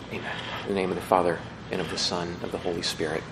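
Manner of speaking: soft, low, reverent prayer voice; hushed prayer. Real, not acted. Priest praying for the dead with his congregation, hushed and reverent. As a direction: lead the congregation quietly in prayer.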